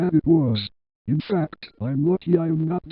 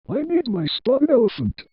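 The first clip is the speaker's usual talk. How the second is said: higher-pitched, lightly excited cry; mild exclamation; 7 semitones higher than usual. A narrator's reading. Penny at home exclaims to Rudy, mildly excited. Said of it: "exclaimed Penny".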